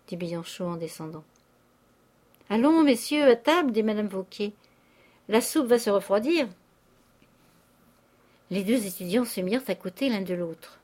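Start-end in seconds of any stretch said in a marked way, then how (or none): 2.5-6.5 loud, higher call to dinner. A narrator's reading. Madame Vauquer calling boarders to table, loud brisk call.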